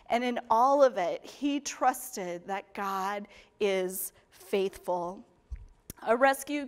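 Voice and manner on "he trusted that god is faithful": slow emphatic refrain. Real, not acted. Sermon refrain, slowed for emphasis.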